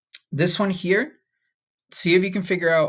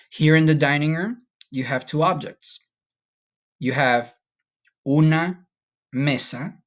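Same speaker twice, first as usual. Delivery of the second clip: normal talking voice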